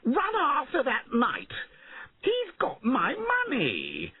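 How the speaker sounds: high, wailing command; frantic outraged lord, words stretched; angry shouting. A narrator's reading. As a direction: send them chasing with a high yell. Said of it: "he shouted at them".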